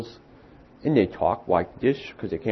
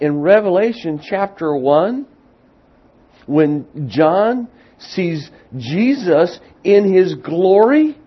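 higher and louder, slowing on his glory; rising preaching; 9 semitones higher, 8 dB louder, slower than usual. Acted. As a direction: lift it, then slow down. Preaching rises.

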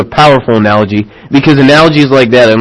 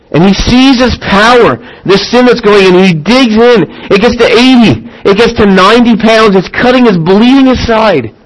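building intensity; 7 semitones higher than usual. Acted. Climax of the battle.

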